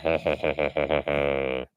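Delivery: low, quiet drawn-out chuckle; a long run of low heh-heh-hehs. Real, not acted. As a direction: chuckle softly, low in the throat. Chuckles after calling Loki conniving.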